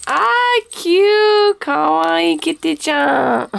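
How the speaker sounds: high, loud squeal over something cute; delighted high squeal, stretching kawaii; excited squeal. Real, not acted. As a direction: gush at the cute kitty, slow on kawaii.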